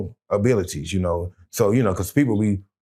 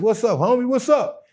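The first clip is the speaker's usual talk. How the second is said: excited greeting; 12 semitones higher than usual. Retold or imitated. Greeting old friend, retold.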